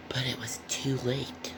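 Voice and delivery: hushed dramatic whisper. A narrator's reading. Reader whispers the sad turn to the children. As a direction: breathe it out, all voice gone. Repeated story line whispered.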